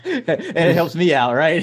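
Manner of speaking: words pushed out through laughter, high; laughing as he talks. Real, not acted. Laughing at the parenting joke with his cohost.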